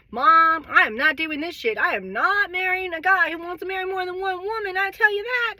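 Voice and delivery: a hint of defiant child; imitating the kids. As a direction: voice the fed-up kids. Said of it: "what the kids were thinking".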